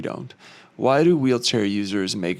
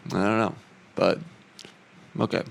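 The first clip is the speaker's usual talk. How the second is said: ordinary talk, nothing marked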